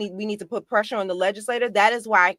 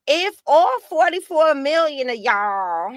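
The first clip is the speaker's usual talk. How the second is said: mocking Southern drawl, higher and slow; pretend Southern accent; 7 semitones higher, 4 dB louder than usual. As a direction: imitate her put-on Southern accent, drawn out. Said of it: "with her pretend Southern accent".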